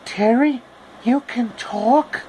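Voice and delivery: high, slow amazed question; surprised. Acted. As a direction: gasp it out, amazed. Dorothy surprised at talking raccoon.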